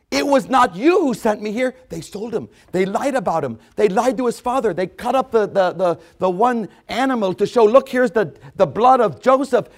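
ordinary delivery

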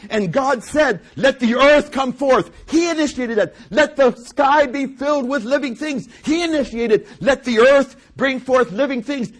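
loud, high-pitched pulpit fervour; excited, driving proclamation; impassioned preaching. Real, not acted. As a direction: cry out each command like a preacher. Preaches at full force.

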